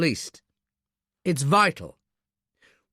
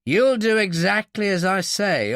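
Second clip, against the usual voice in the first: louder, higher, forceful ultimatum; firm threat; 7 semitones higher, 5 dB louder than usual. Acted. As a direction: lay down the law. Captain threatens the obstinate officer.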